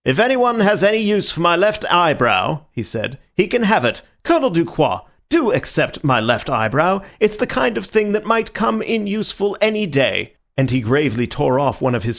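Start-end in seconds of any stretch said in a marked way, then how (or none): 0.0-10.3 bright, loud, hearty offer; loud cheerful voice, higher. A narrator's reading. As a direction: play it loud and jolly. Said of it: "in a loud and cheerful voice".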